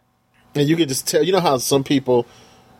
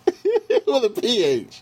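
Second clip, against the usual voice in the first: high, slow, laughing repeat; 14 semitones higher, slower than usual. Real, not acted. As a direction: laugh out the words. Laughing at the nickname Fresh.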